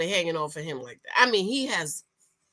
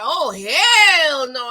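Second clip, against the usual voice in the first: slow, loud drawn-out refusal; flat refusal; 4 semitones higher, 9 dB louder, much slower than usual. Retold or imitated. Marla refusing the deal, slow loud refusal. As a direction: stretch it out, scandalised. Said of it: "was like".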